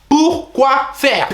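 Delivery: high, very slow incredulous repeat; slow drawn-out disbelief. Retold or imitated. Nephew repeating the outraged question, slow and drawn out. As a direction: stretch each syllable in disbelief.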